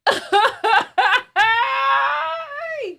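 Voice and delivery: high laugh ending in a long squeal; real laughing fit. Real, not acted. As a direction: burst out laughing, high, into a drawn-out cry. Breaks into loud laughing fit.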